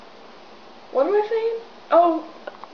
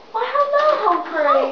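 high, cheery greeting; 6 semitones higher than usual. Acted. Greeting a guest in a scene.

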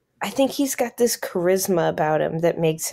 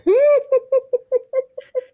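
high laugh; 4 dB louder than usual. Real, not acted. Burst of laughter at quote.

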